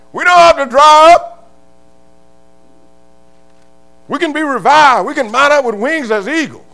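loud, high shouting preacher; preaching at full force. Real, not acted. Preacher shouting to his church about God's leading. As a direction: let it ring out over the congregation.

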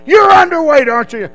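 high, loud accusing shout; barking officer. Retold or imitated. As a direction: bark it at the recruit. Officer challenges the recruit.

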